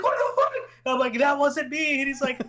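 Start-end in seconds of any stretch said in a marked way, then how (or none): 0.0-0.5 very high-pitched mock freak-out; panicked outcry. Player under another airstrike, panicked high squeal. 1.2-2.0 high-pitched laughing denial; protesting innocence. Retold or imitated. Player denying the second airstrike, high amused protest.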